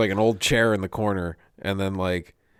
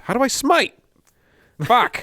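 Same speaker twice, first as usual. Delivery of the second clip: high, loud mock frustration; exasperated Satan; 6 dB louder than usual. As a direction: play the devil losing it at a gadget. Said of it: "I'm just picturing Satan with eyeglasses".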